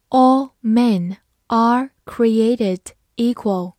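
slow, word by word with pauses; slow read-through. Shown as a demonstration. English teacher reads the example sentence for learners, slowly. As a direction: read it out slowly, each word set apart. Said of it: "慢读一遍".

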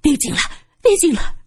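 high-pitched panicked begging; frantic pleading cries. A narrator's reading. Tenant hides her face from Su San, pleading frantically. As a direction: beg repeatedly in fright. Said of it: "不住地喊着".

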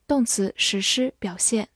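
usual speaking voice

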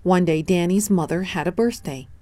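plain reading voice

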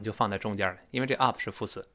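ordinary delivery

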